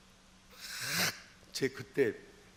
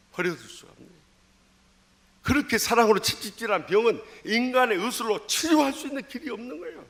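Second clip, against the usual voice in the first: preaching at full force; 6 semitones higher, 5 dB louder than usual. Acted. Preacher exhorts congregation.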